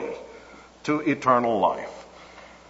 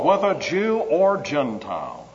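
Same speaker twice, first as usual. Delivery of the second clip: usual speaking voice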